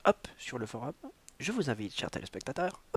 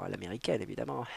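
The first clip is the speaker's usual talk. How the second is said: ordinary talk, nothing marked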